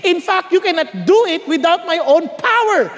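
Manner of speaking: ordinary talk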